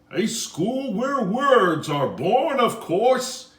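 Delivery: slow, high and loud unseen voice; mysterious voice. Acted. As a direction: call out grandly like a hidden voice. Mysterious voice answers from nowhere.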